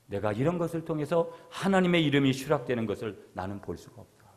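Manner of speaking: ordinary delivery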